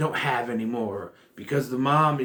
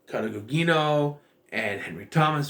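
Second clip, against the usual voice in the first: ordinary talk, nothing marked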